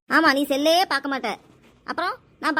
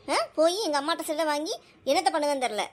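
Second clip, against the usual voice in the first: ordinary delivery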